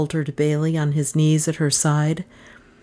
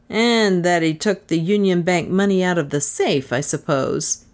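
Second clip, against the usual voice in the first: plain reading voice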